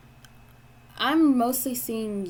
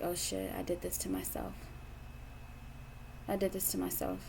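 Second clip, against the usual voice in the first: quiet self-blame; 10 dB quieter than usual. Retold or imitated. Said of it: "And it's like".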